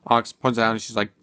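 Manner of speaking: ordinary delivery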